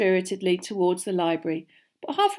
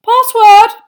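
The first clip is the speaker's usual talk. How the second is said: startled portrait lady; 20 dB louder than usual. A narrator's reading. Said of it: "jerking out of a doze".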